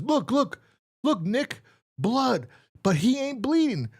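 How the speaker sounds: high, hammed-up panicked squeak; killer voice. The killer pointing out the blood to Nick, squeaking high. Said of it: "he goes".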